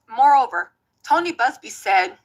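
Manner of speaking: usual speaking voice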